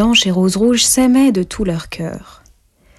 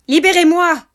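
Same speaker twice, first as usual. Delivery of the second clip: much higher and louder cry; angry cry; 10 semitones higher, 5 dB louder than usual. A narrator's reading. Dwarf caught in the fishing line cries out loud. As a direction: yell it, a trapped dwarf demanding. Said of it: "s'écria le nain".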